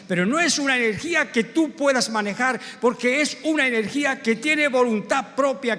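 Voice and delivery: full-force evangelical preaching, high and loud. Acted. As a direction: build the sermon with fervour, pounding key words.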